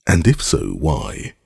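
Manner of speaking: ordinary delivery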